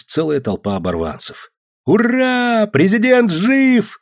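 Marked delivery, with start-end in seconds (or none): 1.9-4.0 high, loud, drawn-out cheer; jubilant shout. A narrator's reading. Student cheers the returned president, jubilant shout. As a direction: hurrah it at full voice, stretching the words. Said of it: "закричал студент".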